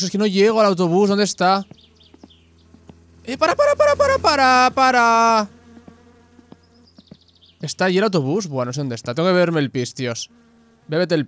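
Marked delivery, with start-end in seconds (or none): 3.2-5.5 higher, louder pleading para; yelling stop. Pretend. Player yelling at the bus to stop, pleading shout. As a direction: flag down the leaving bus.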